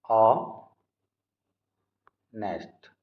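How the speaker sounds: ऑनेस्ट drawn out very slowly, silent h; ऑनेस्ट spoken slowly and softly with no h; slow demonstration. Shown as a demonstration. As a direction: stretch ऑनेस्ट slowly, opening on the vowel. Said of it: "जब pronounce करेंगे तो आ".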